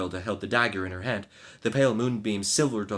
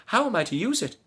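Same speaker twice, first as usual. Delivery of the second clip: terrified high question; 12 semitones higher, 3 dB louder than usual. Acted. Maid terrified by the dagger, high frightened question. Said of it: "exclaimed the maid in terror".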